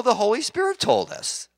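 ordinary talk, nothing marked

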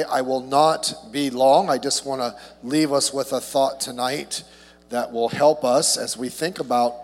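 usual speaking voice

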